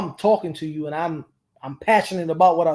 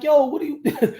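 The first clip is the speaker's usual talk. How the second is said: baffled; 10 semitones higher, 4 dB louder than usual. Retold or imitated. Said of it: "I'm like".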